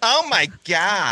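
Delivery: put-on slow, camp valley-girl voice; camp American accent. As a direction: drawl it like LA. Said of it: "I can do American in this way".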